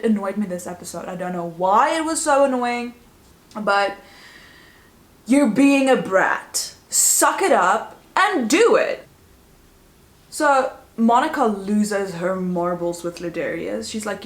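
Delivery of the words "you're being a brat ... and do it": scolding. Real, not acted. Scolding Lexi.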